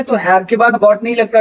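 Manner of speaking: ordinary talk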